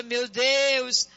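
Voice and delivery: normal talking voice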